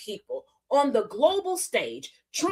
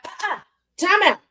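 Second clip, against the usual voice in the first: loud, higher call of a foul; mock referee call; 4 semitones higher, 4 dB louder than usual. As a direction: throw the flag on her. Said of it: "Mister Lieu was like".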